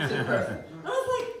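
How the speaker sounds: ordinary talk, nothing marked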